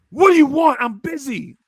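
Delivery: irritated boss bark. Retold or imitated. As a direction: snap it out like a busy boss. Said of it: "He'd be like, put his cup down".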